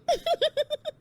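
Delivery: smug giggle after the jab; mocking high laugh. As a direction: laugh at her, pleased with yourself. Bride laughs after taunt.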